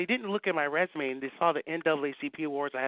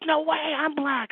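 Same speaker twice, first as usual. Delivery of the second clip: amazed exclaim; 11 semitones higher, 7 dB louder, slower than usual. Retold or imitated. Retold amazed reply on the phone.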